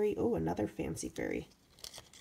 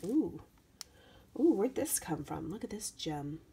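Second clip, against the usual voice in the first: a breathy hush of wonder; awed whisper. Real, not acted. Whispering to viewers over a sparkly gem in happy mail.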